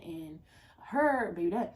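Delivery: normal talking voice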